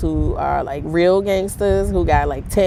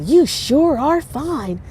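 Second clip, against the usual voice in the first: drawn-out, higher, slow mock pickup line; funny stranger's catcall; 8 semitones higher, much slower than usual. Woman mimics street stranger's slow catcall to viewers. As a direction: stretch it out like the weird guy did. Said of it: "he just sounded funny".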